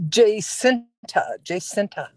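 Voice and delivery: Jacinta said slowly as "jay-SIN-tah", then repeated; correcting name pronunciation. Shown as a demonstration. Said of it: "Jacinta or Jacinta?".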